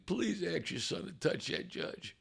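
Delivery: high, hushed urgent begging; retold begging plea; begging prayer. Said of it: "I'm begging".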